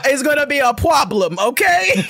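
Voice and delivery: high, loud exasperated warning; high exasperated threat. Retold or imitated. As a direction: warn him, all worked up. Retold threat to brother.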